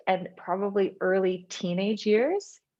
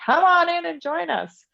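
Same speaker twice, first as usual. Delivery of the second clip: high, slow, open-armed welcome; welcoming invite; 11 semitones higher, 4 dB louder than usual. Retold or imitated. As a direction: call guests in like a happy host. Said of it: "they were like".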